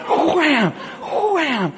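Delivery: high, laughing mock whacks; squealing the blows out through a laugh; laughing wham sounds. Imitating the kids hitting the trees, high and laughing.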